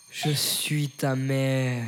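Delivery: slow, drawn-out solemn reveal; mock-solemn slow announcement; film villain parody. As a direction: stretch each word for a grand revelation. Parody revelation, corrected take.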